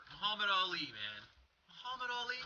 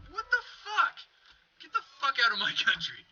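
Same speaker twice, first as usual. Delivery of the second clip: high, loud "what the fuck"; outraged yell; 9 semitones higher, 5 dB louder than usual. Real, not acted. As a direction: blurt it in disbelief. Ranting over Vancouver.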